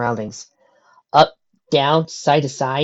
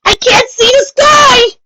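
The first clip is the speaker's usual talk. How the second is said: high, loud, slow sobbing shout; crying yell; 13 dB louder, slower than usual. A narrator's reading. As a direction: howl in terror, almost crying. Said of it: "Dash yelled".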